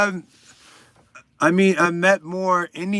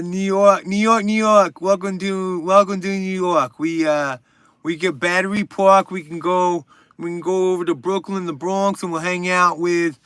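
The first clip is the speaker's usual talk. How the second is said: bad New York accent; 3 semitones higher than usual. Streamer imitating locals heard in New York, bad accent. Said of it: "that's kind of a bad accent too".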